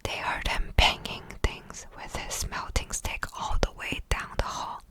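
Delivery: ordinary delivery, nothing marked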